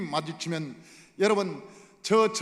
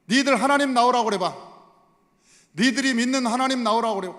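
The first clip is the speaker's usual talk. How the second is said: loud, jeering challenge; mocking taunt; 2 semitones higher, 5 dB louder than usual. Goliath jeering at Israel about their God, loud mockery. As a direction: goad them with scornful questions. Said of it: "나중에는 뭐까지 조롱을 하냐면".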